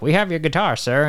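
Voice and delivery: high, polite mock British butler; British accent. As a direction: play a stiff English servant. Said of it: "and they're like".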